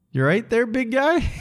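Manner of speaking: teasing friend voice. Retold or imitated. Friend teasing him awake.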